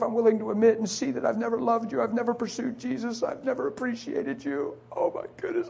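ordinary delivery

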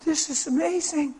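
very simply. Retold or imitated. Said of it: "he very simply".

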